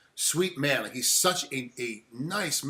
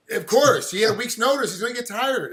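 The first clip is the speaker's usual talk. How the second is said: louder, higher insistence; emphatic outburst; 6 semitones higher, 5 dB louder than usual. Real, not acted. Host insisting to guest, emphatic and louder. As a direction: insist it's obvious, voice rising. Host defending fighter's short notice.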